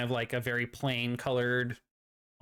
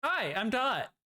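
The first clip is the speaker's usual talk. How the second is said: higher, bright chirpy robot greeting; 12 semitones higher, 7 dB louder than usual. Acted. Dot the AI greeting the governor, chirpy and high. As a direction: pop in like an eager little AI.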